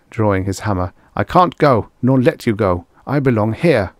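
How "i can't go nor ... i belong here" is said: raised, mildly agitated refusal; blurted protest. A narrator's reading. Man drawing his hammer on her, blurting a refusal. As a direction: blurt it out, starting higher and louder. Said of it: "he blurted".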